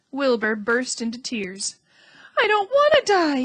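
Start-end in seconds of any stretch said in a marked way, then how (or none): 2.4-3.4 high, louder moaning wail; a piglet moaning in despair. A narrator's reading. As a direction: let it drag out as a whimpering plea. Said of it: "he moaned".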